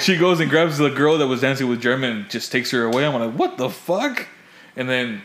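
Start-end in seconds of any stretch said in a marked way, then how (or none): 3.4-4.3 high-pitched retold bafflement; high, puzzled protest at normal volume; baffled. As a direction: exclaim it high in mock disbelief. Retells reaction to her leaving.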